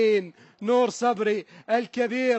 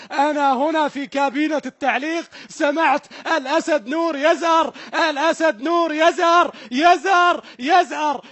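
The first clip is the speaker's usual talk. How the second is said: loud, high, overjoyed cheering call; screaming with delight from the booth; ecstatic commentary yell; 4 semitones higher, 6 dB louder than usual. Real, not acted. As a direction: let the joy burst out over the goal. Commentator erupts at the goal.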